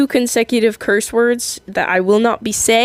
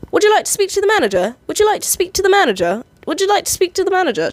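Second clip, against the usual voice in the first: higher, fast, chirpy repeated offer; unwavering customer-service voice; 9 semitones higher, faster than usual. Retold or imitated. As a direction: stay in character, same bright line each time. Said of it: "unwaveringly like".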